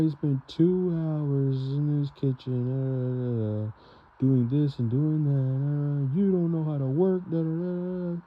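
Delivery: high, slow, whiny mock rant of a nagging grandfather; lampooning a grandfather who keeps score of his chores, in a high whiny voice. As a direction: exaggerate his complaining in a high put-on voice. Grandfather's rant mocked in retelling.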